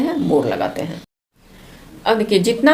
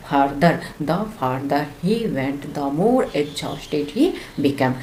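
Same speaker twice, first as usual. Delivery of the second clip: each word slow and a little softer; slow, soft example read; 4 semitones lower, 7 dB quieter, much slower than usual. Shown as a demonstration. Teacher quietly reading an example line to her students, slowly. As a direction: lay the sentence out gently and slowly. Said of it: "teacher reads example sentence".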